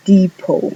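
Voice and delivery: depot with silent final T, "dipo"; English word depot. Shown as a demonstration. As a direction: drop the T of depot. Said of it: "la T de al final no suena". ("depot" sin la T final)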